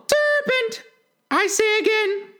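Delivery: very high bird voice, only slightly subdued; subdued pigeon. A narrator's reading. Pigeon repeating its charge to Alice, high, mildly subdued voice. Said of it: "in a more subdued tone".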